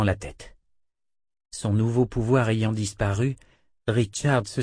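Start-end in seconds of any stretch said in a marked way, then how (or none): none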